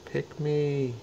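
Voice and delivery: high, pleading "pick me" as the puppy; high, hopeful puppy plea; begging dog voice. Pretend. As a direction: voice the dogs begging to be chosen. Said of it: "Anybody wanna make any last sort of".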